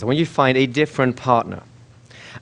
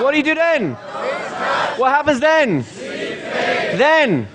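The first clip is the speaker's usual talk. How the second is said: high, insistent pretend panic; mock freak-out; 12 semitones higher than usual. Trainer pressing the class for each next step, mock freak-out. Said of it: "I'm freaking out".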